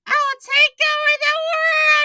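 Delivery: high, loud villain boast; evil teacher announcing plan; villain shout. Acted. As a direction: shout it like a madwoman.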